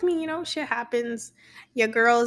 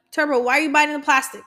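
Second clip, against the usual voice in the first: quick, louder telling-off of a pet; scolding the cat; 5 semitones higher, 6 dB louder, faster than usual. Real, not acted. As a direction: snap at the cat chewing plastic. Scolding her cat mid-recording.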